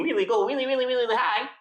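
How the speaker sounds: squeaky high pitch demo; really high voice. Shown as a demonstration. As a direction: voice pushed way up high, far from normal. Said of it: "You can have different pitches".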